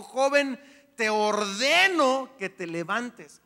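loud, slow, solemn command. A narrator's reading. Jesus commands the dead young man to get up, loud and slow. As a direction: order the dead to rise.

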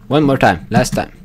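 normal talking voice

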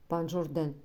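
plain reading voice

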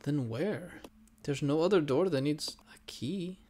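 soft unvoiced whisper. Real, not acted. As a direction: whisper it to yourself. Unvoiced, muttered to self.

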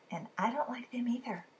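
whispered confession. Real, not acted. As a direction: drop to a breathy secret. Confessing fear of widows.

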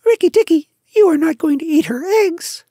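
very high, loud chirpy bird voice; a shrill little bird, bursting with triumph; tailorbird. A narrator's reading. Bird tells the mongoose the news, triumphant. Darzee speaking.

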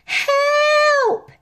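high, loud falling scream for help. A narrator's reading. Harry falling from the window, screaming. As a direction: scream as you fall. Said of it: "he screamed".

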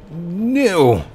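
high, drawn-out cry of dismay; dismayed outcry. Real, not acted. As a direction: cry out as it all goes wrong. Reacting to game mishap.

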